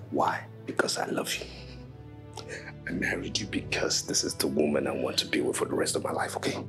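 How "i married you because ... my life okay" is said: low, soft, urgent reassurance; hushed pleading. Acted. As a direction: plead quietly with someone you love. Husband pleads with wife to stay.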